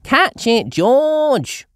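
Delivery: high, loud call; Peppa shouting. A narrator's reading. Peppa shouting to George to catch the hat. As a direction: shout after your brother. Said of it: "shouts Peppa".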